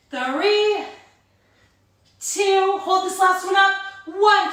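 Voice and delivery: high, loud motivating countdown; loud coaching. Real, not acted. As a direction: push them through the last reps. Intense countdown, pushing the class.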